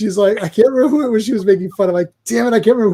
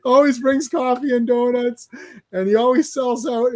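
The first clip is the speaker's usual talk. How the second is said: laughing while retelling; 5 semitones higher than usual. Real, not acted.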